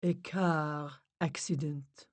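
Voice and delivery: slow, soft, fading to a whisper; hushed medium. Acted. Medium relays the spirits, slow and hushed. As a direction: speak gently as a spirit medium. Medium speaks to the dead softly.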